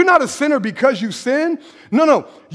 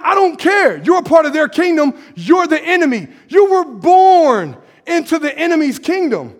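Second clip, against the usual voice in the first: fiery preaching; 3 semitones higher than usual. Real, not acted. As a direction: confront the congregation. Preaching at full force.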